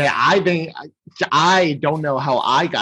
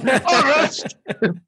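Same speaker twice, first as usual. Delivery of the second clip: high, drawn-out hammy order; Burgermeister; 11 semitones higher, much slower than usual. Acted. Burgermeister demanding an arrest, high over-the-top wail. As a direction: wail the command in mock outrage. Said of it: "how over the top the Burgermeister is".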